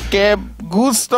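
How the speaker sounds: playful mock Spanish accent; put-on Spanish accent. Caster introducing himself in a hammed-up Spanish accent. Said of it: "What's the accent for?".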